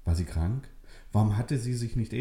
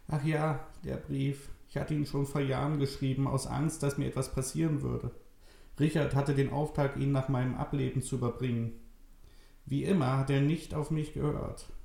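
put-on higher, thin old lady voice; old woman Maria; 6 semitones higher than usual. Old Maria tells Nele it's true, frail old voice. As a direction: speak as a frail old grandmother.

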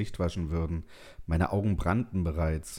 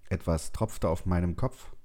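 ordinary delivery, nothing marked

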